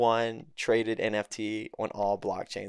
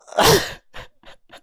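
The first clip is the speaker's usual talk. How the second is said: shocked breathy laugh; 10 dB louder than usual. Real, not acted. Shocked laugh at rug reveal.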